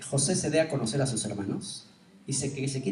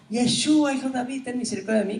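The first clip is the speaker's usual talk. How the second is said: crying out; 8 semitones higher than usual. Retold or imitated. Woman shouting after Yeshua, high pleading cry. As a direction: call out desperately for mercy. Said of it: "esta mujer va gritando".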